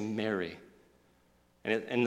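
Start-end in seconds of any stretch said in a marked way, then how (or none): 0.0-0.6 low, hushed tenderness; gentle naming. Acted. Jesus just saying her name to her, gentle. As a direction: speak the name softly and low. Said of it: "Jesus has to just say".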